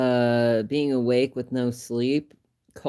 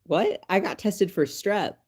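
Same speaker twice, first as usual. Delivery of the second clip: higher, incredulous what; startled mock protest; retold shock; 8 semitones higher than usual. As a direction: act out the disbelief. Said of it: "And I was like".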